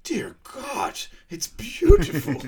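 high, slow, loud breathless awe; awed. Retold or imitated. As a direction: gasp it out in mock wonder. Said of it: "Jacobeam's like".

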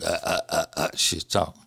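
low, halting strained stutter; imitating a spasming voice. Son imitating his mother's voice spasm, low halting stutter. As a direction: stutter low as if the cords lock up. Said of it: "her vocal cords would go into a spasm".

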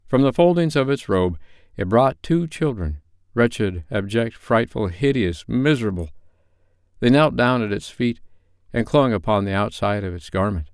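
story narration. A narrator's reading.

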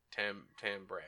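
"timbre" said softly as TAM-bray; quiet, halting "timbre" with tam, then bray. Shown as a demonstration. Cohost still testing the word, "timbre" as TAM-bray.

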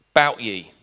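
Belfast greeting, loud and low; Northern Irish accent. Shown as a demonstration. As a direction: say it like a Belfast local. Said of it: "That sounds dreadful".

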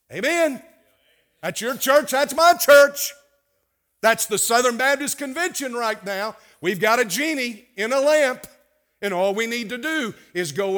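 loud, high, fiery pulpit shout; rally the church, full volume; shouted sermon climax, slowing on "that's my church"; preaching at full force. Real, not acted.

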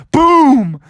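loud imitated heavy thud; booming footstep. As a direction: boom it out like a giant step.

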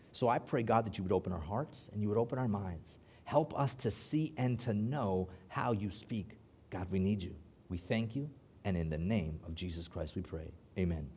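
soft, low prayer voice; hushed pastor's prayer. Acted. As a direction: pray quietly and earnestly. Opening prayer.

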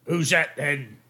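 lightly voiced goblin guard; a hint of a suspicious goblin challenge. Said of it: "And in Goblin, they're like".

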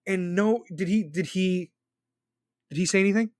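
ordinary delivery

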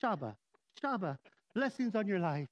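hammed-up emotionless blessing; mock saintly. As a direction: sigh it out as an exaggeratedly serene saint. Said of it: "I thought you had to be like".

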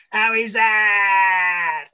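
loud, high, drawn-out appeal; appeal shout. Retold or imitated. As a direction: bellow the appeal like a fielder. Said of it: "all the Aussies are shouting and screaming".

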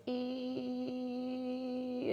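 long, high, steady electric whine; angry neon buzz. Shown as a demonstration. Imitating a flashing no-vacancy sign, drawn-out buzz. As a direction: hold a thin buzzing "ee" like a neon light. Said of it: "like, real angry".

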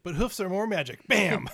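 rising to a loud bam; excited punchline. Pretend. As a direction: build up and slam the last word. Punchline shouted.

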